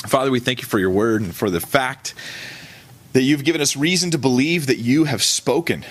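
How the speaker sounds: closing prayer. Acted. Pastor leading the congregation in closing prayer, reverent. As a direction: pray over them gently.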